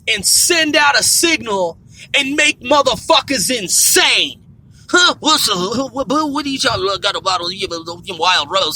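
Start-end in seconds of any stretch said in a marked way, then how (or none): 4.9-8.8 low phone voice. Retold or imitated. Customer talking into phone.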